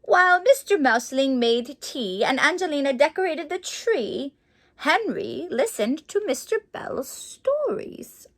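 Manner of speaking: ordinary delivery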